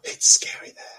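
gasped, whispered mock fear; a hammed-up frightened breathy hush; mock scared whisper. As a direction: whisper it as if the ghosts could hear. Mock fright in ghost tale.

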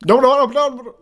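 high loud no no no; loud protest. Real, not acted. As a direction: shout the refusal. Reacting loudly to friend.

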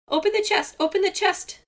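very high, loud frantic squawk; hoarse squawk. A narrator's reading. Seagull begging Raven to open the chest, high loud screech. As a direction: screech it, voice worn out. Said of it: "squawked Seagull".

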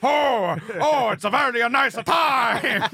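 high, slow, shouted bad Italian accent; mocking Pacino's bad Italian accent. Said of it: "could not do an accent".

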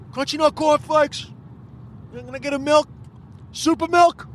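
high-pitched repeat through the window; acted, strained ordering; calling an order. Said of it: "so hard to order at the window".

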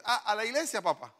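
niño insistente apenas imitado, agudo; niño al papá. Acted. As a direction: imita un poco a un hijo apurando a su padre. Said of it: "El niño educando al padre". (voz de niño levemente actuada)

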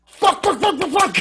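high, loud burst of repeated swearing. Retold or imitated. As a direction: yell each curse sharply as his sleeve catches fire. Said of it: "Really loudly".

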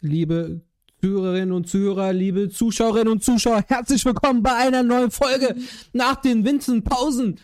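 formal announcer. Acted. Formal podcast opening.

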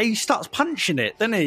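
ordinary talk

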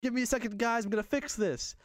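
high, projected superhero act; Spider-Man in character. Retold or imitated. Spider-Man excusing his fallen eye to kids, high hammed-up voice. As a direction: put on a hero's dismay for children.

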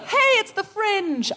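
high, drawn-out joyful call; about to burst into song. Retold or imitated. Childlike festival-goer with arms out, high and near singing. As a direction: announce it slowly like a musical's opening. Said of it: "about to burst into song".

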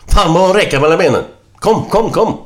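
very high, loud shouted repeat; insistent excited boy. Acted. Little boy shouting his news to mother, insistent. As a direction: urge mum to come at once, shrilly excited.

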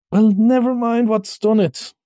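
high-pitched, pained dismissal; complaining in a high voice. Acted. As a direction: brush it off through the hurt. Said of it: "forgetting his grammar in his pain".

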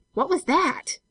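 high, startled gasped question; breathless alarm. A narrator's reading. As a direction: cry out in sudden fright. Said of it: "she cried breathlessly".

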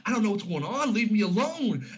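high-pitched agitated protest; Bruce, upset. Retold or imitated. Bruce protests to the visiting heroes, upset and high-pitched. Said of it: "vividly upset".

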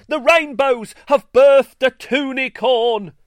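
high, loud crowing shout. A narrator's reading. Greenstar shouting to the town square, loud. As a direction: bellow the news to the whole town.

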